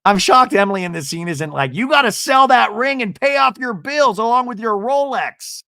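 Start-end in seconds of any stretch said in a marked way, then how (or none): 1.7-5.6 high, loud, hammed-up urging; mock pushy advice. Imagining Emily telling her to sell the ring, mock pushy. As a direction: push it out high and emphatic.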